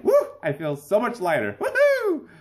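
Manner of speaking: high-pitched gleeful whooping; mock elated cat. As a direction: whoop with comic relief as the cat. Voicing relieved cat bolting from litter.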